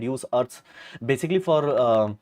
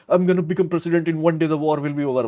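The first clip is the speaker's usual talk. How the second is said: loud, higher, brash boasting impression; mock Trump boast; 5 semitones higher, 5 dB louder than usual. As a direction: ham up a bragging Trump promise. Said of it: "where he's like".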